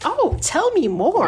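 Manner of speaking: sarcastic interest. Retold or imitated. Passenger mocking the gossip about her childhood, sarcastic. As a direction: tease her with fake interest. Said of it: "mock story of her life".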